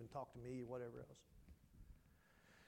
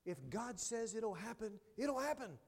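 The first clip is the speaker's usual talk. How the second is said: it'll pushed higher and louder; rising preacher's build; 7 semitones higher, 5 dB louder, slower than usual. Acted. Preacher building the promise to the church, rising. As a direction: climb into a ringing it'll happen.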